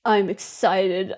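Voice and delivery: low, slow, soft and grim; excited words, angry tone; angry-sounding excitement. Real, not acted. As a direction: claim excitement in a grumpy low voice. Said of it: "I sound angry".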